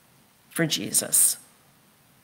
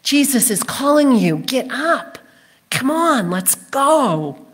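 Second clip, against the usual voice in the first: higher-pitched, eager urging; rally someone onto their feet; acted crowd cheering someone on; encouraging; 6 semitones higher than usual. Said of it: "They are encouraging him".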